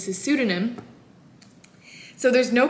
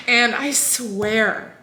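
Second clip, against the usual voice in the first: ordinary delivery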